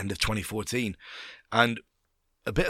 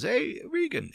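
calm Swiss boss; slower than usual. Retold or imitated. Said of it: "Swiss guy, he's very calm".

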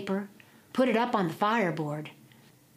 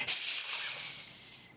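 cat hiss. Acted. Old cat hisses back at Jack's knife.

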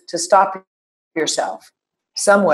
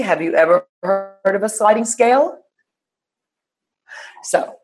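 ordinary talk

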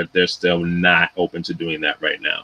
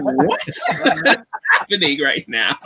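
high-pitched, laughing disbelief; pitched-up comic bewilderment; retold bewilderment; 9 semitones higher, slower than usual. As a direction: exclaim it in mock alarm, cracking up. Retelling his reaction to the screaming.

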